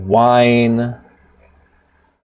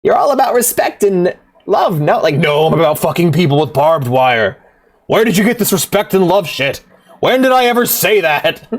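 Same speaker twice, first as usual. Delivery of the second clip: devil; 6 semitones higher than usual. Retold or imitated. Devil mocking modern Satanists.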